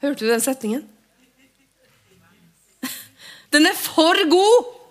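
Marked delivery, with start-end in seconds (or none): 3.5-4.8 high, loud and thrilled; delighted exclamation. Acted. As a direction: burst out with joy. Jubler over setningen. (begeistret utrop, høyt og lyst)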